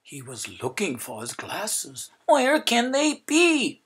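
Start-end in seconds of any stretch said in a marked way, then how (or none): 2.3-3.8 high, slow, worried search; puzzled old painter. A narrator's reading. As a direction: fret over the lost glasses. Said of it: "he said again and again".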